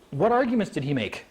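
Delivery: ordinary talk, nothing marked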